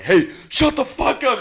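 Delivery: yelled shut up. Retold or imitated. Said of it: "when you were like".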